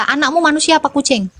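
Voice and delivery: ordinary talk, nothing marked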